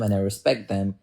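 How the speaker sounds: usual speaking voice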